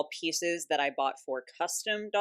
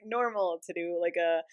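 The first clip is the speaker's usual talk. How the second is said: ordinary talk, nothing marked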